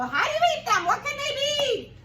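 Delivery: very high, loud squeaky wonder; puzzled townspeople. Acted. Townspeople baffled by the strange food, very high and loud.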